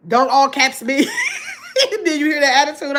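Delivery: playful protest dissolving into high laughter; laughing mock protest. Real, not acted. Host teasing a chatter about all caps, laughing. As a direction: tease through a laugh.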